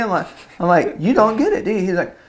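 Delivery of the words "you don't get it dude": high, exasperated retold protest; exasperated retort. Son to dad who misses the point, exasperated. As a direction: protest in a raised pitch, mock exasperated.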